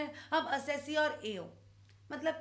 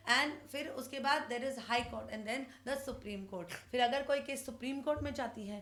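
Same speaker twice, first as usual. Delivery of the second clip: normal talking voice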